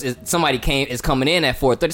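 normal talking voice

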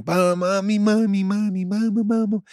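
put-on high, wailing child calling for her mommy; a mock sobbing kid calling mama; imitating a crying little girl. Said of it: "I hear a little girl crying".

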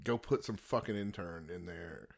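dropped low and casual, like a lazy exec; lightly voiced lazy exec; mock careless studio exec. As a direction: toss it off like a bored boss. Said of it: "just be like".

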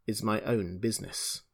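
measured, deliberate finish; slowly. A narrator's reading. Adya finishing his reply to the voice, slowly. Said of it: "he said slowly".

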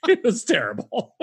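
laughing through the words; a real laugh breaking into the verdict. Real, not acted. Laughing at Janney's bad accent.

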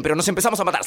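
normal talking voice

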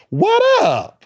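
high, far-off squeaky cartoon voice; mascot saying what up. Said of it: "The first thing he says is".

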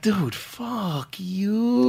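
very slow, drawn-out mock brush-off; dismissive. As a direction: drag it out like a sarcastic kiss-off. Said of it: "I'm just like".

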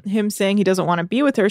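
usual speaking voice

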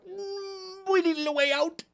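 very high, whimpering voice; tearful, whining excuse; cowering. Acted. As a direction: squeak it out like a cornered coward. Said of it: "He just fucking cowers".

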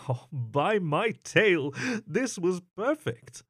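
a hint of scheming glee; gleeful. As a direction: relish a perfect chance. Narrator gloating over opportunity.

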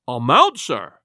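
incredulous cry of amount; cried out. A narrator's reading. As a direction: scoff at the very question. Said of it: "cried the squire".

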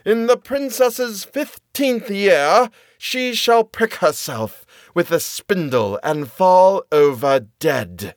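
high, slow, booming curse; wicked fairy curse. A narrator's reading. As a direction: pronounce a doom like a vengeful witch. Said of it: "she cried out with a loud voice".

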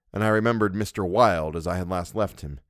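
ordinary delivery, nothing marked

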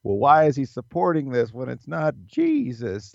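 a hint of a smug contrarian; mock provocateur. Playing devil's advocate to make people squirm, mock needling.

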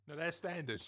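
normal talking voice

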